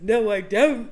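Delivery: loud, indignant mock British denial; hammed-up British, breaking into laughter; terrible British accent. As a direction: protest in a bad British accent, half laughing. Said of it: "You have a terrible British accent".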